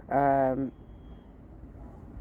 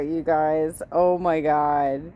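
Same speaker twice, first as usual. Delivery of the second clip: usual speaking voice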